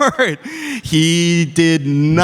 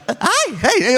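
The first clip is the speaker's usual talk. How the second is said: much higher, stammering greeting; flustered stutter; 10 semitones higher than usual. Shown as a demonstration. Actor showing how he greets a crush, high and stammering. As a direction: stumble over hi like a nervous crush. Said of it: "I do this".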